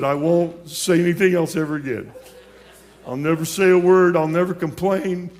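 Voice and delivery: desperate loud vow. Retold or imitated. Terrified promise to God.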